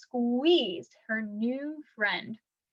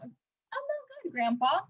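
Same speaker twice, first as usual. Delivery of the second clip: slow, soft, quavering and higher; tearing up; 5 semitones higher, 4 dB quieter, slower than usual. A narrator's reading. Melba despairing to Grandpa John, tearful and soft. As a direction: near tears, voice breaking. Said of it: "tearing up".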